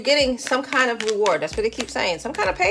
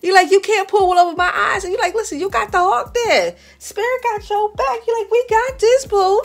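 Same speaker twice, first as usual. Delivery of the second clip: high, loud, sassy hype talk; hyped-up cheering; 8 semitones higher, 6 dB louder than usual. Acted. Reader hyping up Aquarius's intuition, high and excited. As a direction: pitch it way up and cheer them on.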